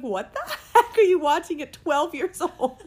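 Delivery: high, loud, laughing mock mother; mom laughing. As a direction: imitate mom laughing in disbelief. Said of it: "my mom was like".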